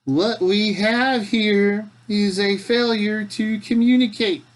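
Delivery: slow, high, loud southern movie quote; hammed-up southern drawl, projected; southern accent. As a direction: draw it out in a big southern voice. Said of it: "slipping into a southern accent when I say that".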